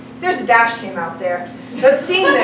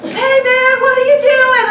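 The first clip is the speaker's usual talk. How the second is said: high, loud yell from a passing car; hollering out the window at a naked woman; rowdy driver calling out, high and loud; 10 semitones higher, 6 dB louder than usual. Retold or imitated. Passing drivers honking and yelling.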